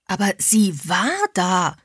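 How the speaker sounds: mild cry of protest; understated exclamation, stretched on the last words. A narrator's reading. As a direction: insist in disbelief that it was there. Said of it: "rief sie".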